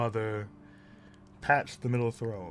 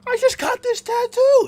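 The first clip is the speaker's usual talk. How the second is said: very high, loud character outcry; comic yelled protest; 16 dB louder than usual. Acted. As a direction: yell it in a high-pitched, hammed-up father's voice. Father acted out, mock outcry.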